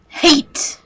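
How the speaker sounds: loud, high furious shout; hateful outburst. Acted. Computer villain erupts at its human captives, furious shout. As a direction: explode on the single word.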